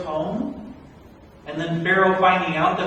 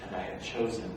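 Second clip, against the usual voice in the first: a gentle final word; soft divine reply; 10 semitones lower, 14 dB quieter than usual. Acted. God calmly telling Moses he is chosen, soft and low.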